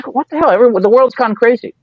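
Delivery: baffled outrage. Retold or imitated. Rip baffled by modern weed.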